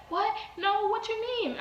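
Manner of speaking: startled manager. Retold or imitated. Manager shocked she quits.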